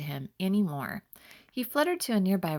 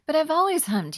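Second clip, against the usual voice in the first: much higher and louder, stung protest; hurt exclamation; 10 semitones higher, 7 dB louder than usual. Acted. Butterfly protesting to the bee, hurt exclamation. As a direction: blurt it out, wounded and surprised. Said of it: "he exclaimed".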